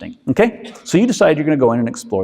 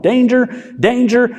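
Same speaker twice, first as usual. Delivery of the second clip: alarmed warning; 9 semitones higher than usual. Acted. Limbic system sounding alarm.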